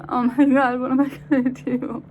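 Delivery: high, loud imitation of wailing; mock sobbing. Friend stranded with a dead car, mock sobbing. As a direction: wail in a hammed-up crying voice. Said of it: "intervals of crying, sobbing".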